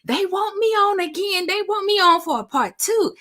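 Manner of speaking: very high, thrilled mimicry; imitating excited mom. Daughter imitating mom telling her husband the news, excited. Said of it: "she was so excited, and she told him".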